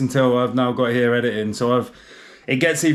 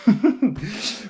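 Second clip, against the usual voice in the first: laughing at himself; 7 semitones higher than usual. Real, not acted. Laughs at own joke.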